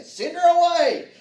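high, loud, slow dismissive shout; impatient shout. Acted. Disciples telling Jesus to send her away, loud impatient shout. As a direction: wave her off with a loud demand.

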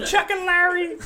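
very high squeal through laughter; laughing outburst. Real, not acted. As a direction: squeal it while cracking up. Joking, cracking up.

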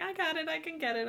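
high-pitched mock insistence; imitated insistent lady. Older listener refusing help with the app, imitated insistence. Retold woman brushing off help.

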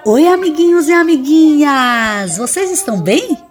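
put-on kids show host. Child host greets audience.

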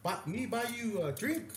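high, slow offer for a foreigner; slow, high pidgin offer; broken-English pickup line. Retold or imitated. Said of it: "Like that, right?".

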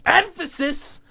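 high-pitched mock proclamation; exaggerated squealed repeat; hammed-up echo. Echoing the co-host's remark, hammed up.

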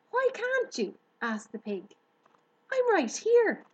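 high, loud eager pig voice; excited young pig. Acted. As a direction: gush with delight at the new friend. Wilbur's voice.

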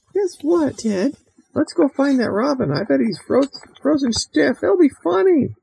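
put-on very high, eager girl voice; gleeful little girl Mary Lou. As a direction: squeal the plan like a mischievous kid.

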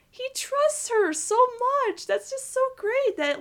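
high, slow and dreamy; swooning. Real, not acted. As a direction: stretch the words out, lovestruck. Fangirling over trust between characters.

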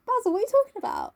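high, quick puzzled reply; mock bewilderment. Voicing Dorian's blithe reply in retelling.

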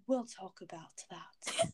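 low, slow, hushed attempt at a British accent; quiet mock-British accent; bad British accent. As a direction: imitate a British teacher, badly and slowly. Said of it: "He also has a British accent".

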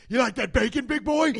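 put-on loud, high jeer at a child; hammed-up aggressive dad; mock taunting. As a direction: goad them over breakfast. Acts Ramsay taunting his children.

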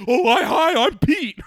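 loud, high goofy greeting with a laugh; Pete Davidson impression. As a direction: say hi like a dopey comic amazed at himself. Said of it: "classic Pete Davidson, like, bit".

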